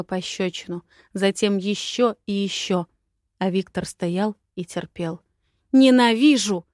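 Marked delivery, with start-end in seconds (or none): 5.7-6.7 loud, higher cry of hatred; venomous acted yell; angry shout. A narrator's reading. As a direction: shout it with all your spite. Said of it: "прокричала со всей злостью".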